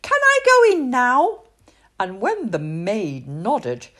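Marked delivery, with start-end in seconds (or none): 0.0-1.4 put-on high eager child's voice; bright pleading little girl, much higher; little princess voice. Little princess begs the maid to swim, high eager voice.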